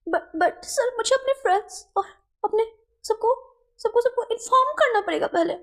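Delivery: high, anxious stammering plea; whiny servant. Acted. Servant pleading with his boss, high and anxious. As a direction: fret in a high childlike voice. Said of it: "wing बोलता है".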